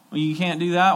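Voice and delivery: usual speaking voice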